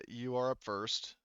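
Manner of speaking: normal talking voice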